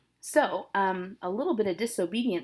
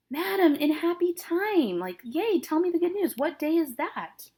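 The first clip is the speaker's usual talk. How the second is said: eager Juliet; 9 semitones higher, 3 dB louder than usual. Acted. Teacher voicing eager Juliet.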